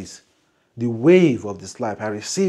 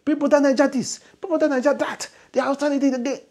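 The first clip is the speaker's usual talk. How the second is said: ranting; 13 semitones higher, faster than usual. Retold or imitated. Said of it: "made this video and be like".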